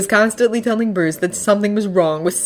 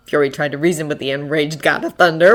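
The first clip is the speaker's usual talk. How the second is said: usual speaking voice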